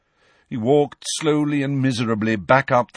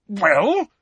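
louder, higher sharp demand; impatient; 11 semitones higher, 6 dB louder than usual. Acted. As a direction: snap for an answer. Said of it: "he added impatiently".

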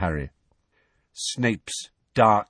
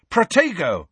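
shouted spell; 9 semitones higher, 4 dB louder than usual. A narrator's reading. Harry casting shield spell.

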